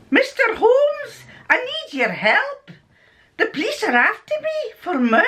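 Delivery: hammed-up Scottish accent, pitched well up. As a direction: plead in a put-on Scottish accent. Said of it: "I've got to do a Scottish accent".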